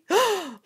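sharp high gasp of delight; excited gasp. Real, not acted. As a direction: gasp as the stamp turns out perfect. Sees the stamped result.

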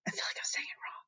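soft unvoiced whisper to the side; whispered aside. Real, not acted. Teacher whispering her doubt to the students. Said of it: "whispered aside to kids".

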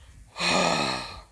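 long, loud, low exasperated groan; heavy sigh. Real, not acted. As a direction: sigh it out in defeat. Giving up on the game.

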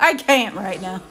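laughing through the words, slow; can't hold back the laugh. Real, not acted.

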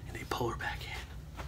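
whisper. Real, not acted. Narrating waitress pulled into dance.

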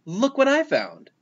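high, excited storybook shout. A narrator's reading. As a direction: call out a proud discovery.

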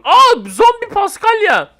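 high, loud, drawn-out surprise; startled exclamation. Real, not acted. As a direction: cry out in amazement. Startled by zombie in game.

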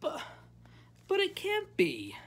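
very high, loud protest; dismayed high-pitched objection; protesting child. A narrator's reading.

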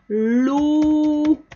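stretched syllable held high; drawn-out prompt. Shown as a demonstration. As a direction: hold the syllable open for the student to finish. Prolonga la sílaba para completar. (sílaba alargada, invitando a completar)

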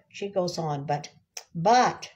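usual speaking voice

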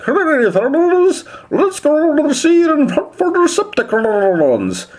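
high, slow, bubbling merman voice; Sea Spray. Retold or imitated. Toy reviewer imitating a cartoon Autobot, merman bubble voice. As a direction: imitate a gurgling underwater merman, drawn out. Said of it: "really nice merman bubble voice".

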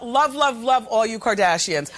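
ordinary talk, nothing marked

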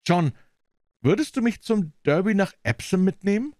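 deutlich höhere Frauenstimme, gespannt fragend; Marjorie, neugierig. Acted. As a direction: als junge Frau drängend nachfragen. Marjorie voiced, higher. (Marjorie fragt Jeans gespannt nach dem Besitzer, mit hoher Stimme)

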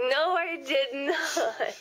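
slow, laughing no; drawn-out protest, breaking into laughter; laughing denial. Real, not acted. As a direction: deny it through laughter. Teasing denial while laughing.

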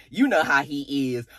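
low, slow, drawn-out father voice; mock fatherly impression, stretching the words. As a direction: impersonate a dad, deep and deliberate. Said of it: "impersonate how his dad was".

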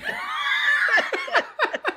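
long high shouting laugh; a big whooping laugh; shouted laugh. Real, not acted.

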